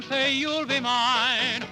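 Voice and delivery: ordinary talk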